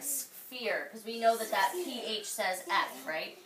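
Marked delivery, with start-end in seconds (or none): 0.0-0.9 "Sphere" said slowly, ph as f; sphere with f sound. Shown as a demonstration. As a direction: model "Sphere" for the class, ph as f. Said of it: "That's kinda what it sounds like".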